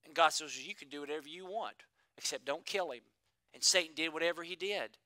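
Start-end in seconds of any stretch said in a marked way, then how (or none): 0.6-3.0 softer, low, hushed voice of God; a hint of a calm, grave God; God's quiet permission. God granting Satan leave, quiet and grave.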